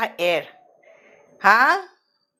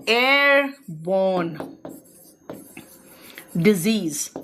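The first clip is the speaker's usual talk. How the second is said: slow, word by word, lower; slow dictation; 5 semitones lower, much slower than usual. Shown as a demonstration. Teacher dictating a term to students, slow and deliberate. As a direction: spell it out slowly for the class. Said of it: "ऐसे लिखते हैं".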